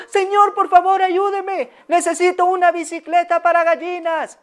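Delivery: high urgent pleading voice; pleading lady. Acted. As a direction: beg a passing stranger for help. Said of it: "salió".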